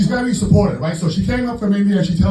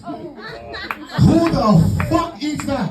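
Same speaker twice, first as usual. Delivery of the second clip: incredulous; 8 semitones higher, much slower than usual. Acted. Comic outrage at bagel.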